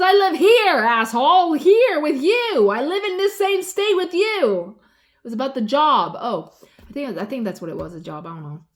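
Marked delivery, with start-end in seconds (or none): 0.0-4.8 loud exasperated retort, higher and louder; retold angry yell. Woman retelling how she yelled at a dense man, loud and exasperated. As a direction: replay the shout at him, spelling out the obvious.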